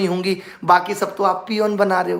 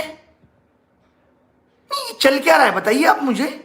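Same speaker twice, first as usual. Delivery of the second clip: mock complaint call; 5 semitones higher than usual. Mock phone call complaining to Modi.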